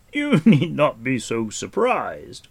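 loud, slow words through a laugh; amused laughter. A narrator's reading. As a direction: reassure grandly while laughing. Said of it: "burst into laughter".

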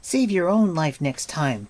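angry retort. A narrator's reading. Taran to Gwydion after the rebuke, angry retort. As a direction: snap back at him. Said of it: "Taran retorted angrily to Gwydion".

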